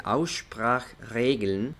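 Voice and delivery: very slow German "Aussprachregeln"; pronunciación alemana. Shown as a demonstration. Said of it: "así se dice". ("Aussprachregeln" en alemán, muy despacio)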